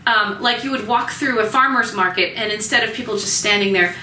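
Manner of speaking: ordinary delivery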